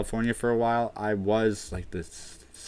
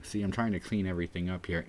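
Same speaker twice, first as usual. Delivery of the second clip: normal talking voice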